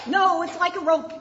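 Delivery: loud, high angry yell. Acted. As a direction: shout him down. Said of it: "yelled the sixth blind man".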